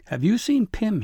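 high, loud, out-of-breath question; breathless. Acted. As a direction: gasp it out after running. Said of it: "breathless and red in the face".

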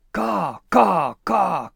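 high, loud squawking crow cries; urging crow caw. Acted. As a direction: caw to hurry him along. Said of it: "Corvus urged from behind".

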